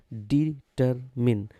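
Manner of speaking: Determine slowly split into डि, टर, मिन; syllable by syllable. Shown as a demonstration. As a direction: break determine into three separate syllables. Teacher says word, then breaks it up.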